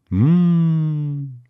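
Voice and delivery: long, high "mmm" of delight; drawn-out appreciative hum; savouring hum. Acted. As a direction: hum with relish over something delicious. Savouring a spoonful of ice cream.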